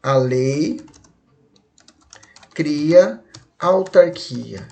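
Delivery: long pause after A LEI, drawn-out CRIA; each word held apart for the class; slow and separated. Shown as a demonstration. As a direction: say "A lei... cria autarquia" very slowly. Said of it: "Se você falar isso aqui rápido".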